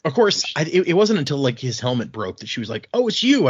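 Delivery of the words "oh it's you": sudden high jump of recognition; surprised realization. Retold or imitated. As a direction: catch the surprise as the helmet breaks. Realizing who he is mid-fight.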